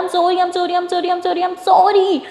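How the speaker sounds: begging over and over, rising to a loud last sorry; loud pleading sorry. Acted. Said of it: "ज़ोर-ज़ोर से बोलने लग जाती है".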